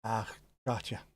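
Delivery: ordinary talk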